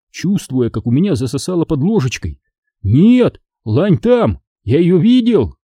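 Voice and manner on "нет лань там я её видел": high, emphatic insistence; alarmed protest. A narrator's reading. Boy insists he saw the deer, alarmed protest. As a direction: refuse to believe it's gone.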